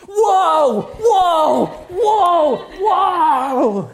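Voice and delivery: very loud, high hollered whoas; wild whooping. Retold or imitated. Preacher now copying the lady at worship time, wild whooping. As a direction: let rip with repeated, full-volume mock whoops.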